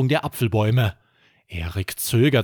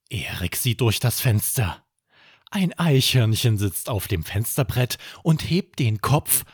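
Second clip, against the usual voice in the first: plain reading voice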